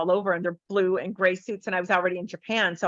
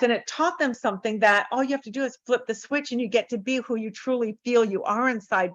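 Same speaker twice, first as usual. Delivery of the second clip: usual speaking voice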